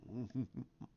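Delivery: soft, slow sinister chuckle; quiet gloating laugh; villain chuckle. Retold or imitated. Snoke gloating as he tempts Rey.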